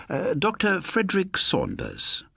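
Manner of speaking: lightly voiced charming stranger; polished introduction. A narrator's reading. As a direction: present yourself with charm. Murderer introduces himself.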